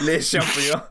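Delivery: hammed-up drunk wrestler with mock French; tipsy mock-French wrestler voice; Chris Jericho. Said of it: "like weird drunk Chris Jericho".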